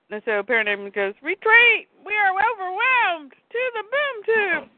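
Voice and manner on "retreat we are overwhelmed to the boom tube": very high put-on creature voice, drawn out; alien minion cry. Parademon orders the overwhelmed troops to retreat, mock minion cry. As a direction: call the retreat as a panicked cartoon minion.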